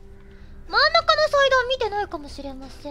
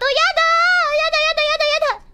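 high, loud wail of refusal; panicked, rapid-fire protest; 取り乱した拒否; 4 semitones higher, 5 dB louder than usual. Real, not acted. 娘に意識があると知り動揺. (取り乱して高い声で嫌がる)

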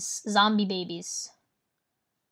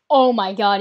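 loud, exasperated outburst; 10 dB louder than usual. Real, not acted. Player reacts to a hated Flame Shield, exasperated outburst. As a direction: burst out in disbelief.

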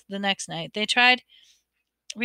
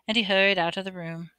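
ordinary delivery, nothing marked

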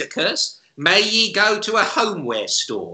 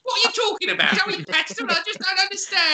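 hammed-up crone whine; 13 semitones higher, 3 dB quieter, faster than usual. Crone character protests, high voice.

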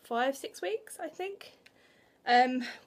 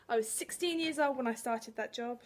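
ordinary talk, nothing marked

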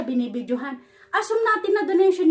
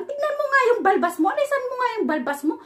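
fast scolding; 7 semitones higher, faster than usual. Real, not acted. Scolding the actor.

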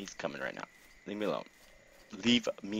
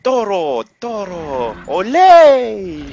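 loud bullfighter cry, rising to a shout; playful high matador holler; mock matador call; 9 semitones higher, 15 dB louder, much slower than usual. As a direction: call the bull, then whoop on ole. Plays matador dodging game enemy.

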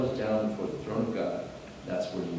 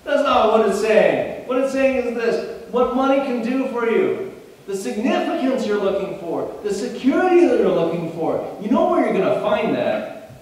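much higher and louder impassioned preaching; full-force preaching; 13 semitones higher, 10 dB louder than usual. Acted.

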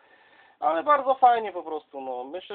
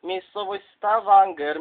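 town name spelled out slowly and louder; slow, clear radio call; 5 dB louder, slower than usual. Real, not acted. Radio operator repeating his town slowly for a distant station. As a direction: say Stavanger slowly so the far station catches it.

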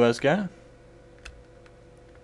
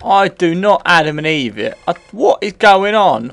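raised, emphatic disbelieving exclamation; loud disbelief; 3 semitones higher, 8 dB louder than usual. Real, not acted. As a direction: stretch it out in loud amazement. Amazed exclamation during game.

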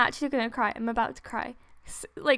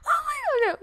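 surprised squeal; 4 dB louder than usual. Real, not acted. Sudden shock at an item.

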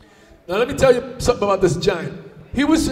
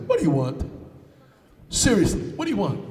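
unimpressed; 3 semitones lower, 5 dB quieter, faster than usual. Acted. Great Spirit weary of argument.

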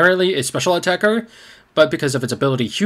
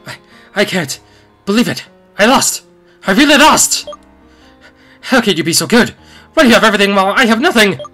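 rising wail of defeat, then fast; distraught villain; 9 semitones higher, 8 dB louder than usual. Acted. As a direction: break down after losing. Defeated reflection voice.

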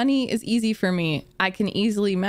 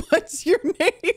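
laughing; 6 semitones higher than usual. Real, not acted. Reading text, breaking into laughter.

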